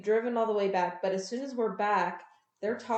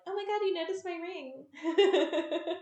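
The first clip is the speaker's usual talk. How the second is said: mock gushing; 9 semitones higher, slower than usual. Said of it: "then we get the, like".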